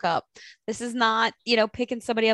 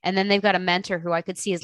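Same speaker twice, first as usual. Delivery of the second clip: ordinary talk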